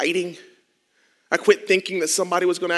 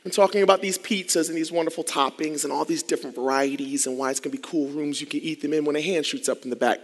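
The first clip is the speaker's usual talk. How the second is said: normal talking voice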